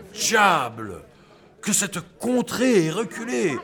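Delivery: ordinary talk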